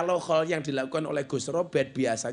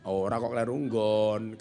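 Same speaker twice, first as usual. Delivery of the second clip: ordinary talk, nothing marked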